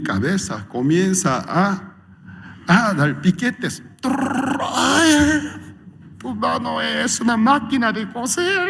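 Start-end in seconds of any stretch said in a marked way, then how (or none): 4.0-8.7 high, whiny pained voice; hammed-up high whine; mock complaining in pain. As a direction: whimper at the needle pricks. Wife's acupuncture hurts him.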